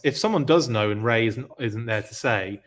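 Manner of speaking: normal talking voice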